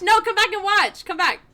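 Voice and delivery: playful shout. Real, not acted. Calling to fleeing survivor.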